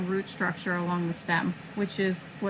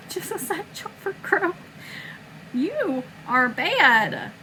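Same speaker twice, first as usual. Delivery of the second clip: scolding the cat; 7 semitones higher, 4 dB louder, slower than usual. Real, not acted. Cat climbs her again.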